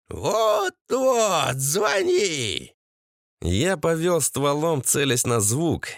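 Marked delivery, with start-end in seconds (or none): 0.1-2.7 high start, dropping into a drawn-out cry; shrieking mother-in-law. A narrator's reading. Mother-in-law eggs on the call. (крикливая тёща)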